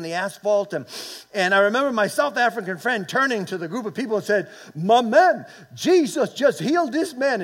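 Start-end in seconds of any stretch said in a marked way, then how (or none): 4.8-7.4 high, loud imitated Dutch accent; old Dutch accent. South African friend turning to the crowd, old Dutch accent. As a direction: proclaim it in a put-on Dutch accent. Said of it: "In his old Dutch accent".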